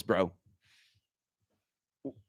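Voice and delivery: ordinary talk, nothing marked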